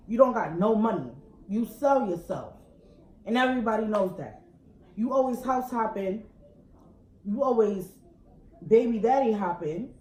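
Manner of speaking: angry rant. Real, not acted. Woman yelling at her partner's side girl, furious and loud.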